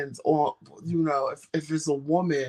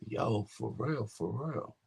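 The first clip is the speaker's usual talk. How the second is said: slow, soft, lowered reply; deep voice for women; 3 semitones lower, 9 dB quieter, slower than usual. Acted. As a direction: answer slowly and quietly, voice down. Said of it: "He uses his deep voice".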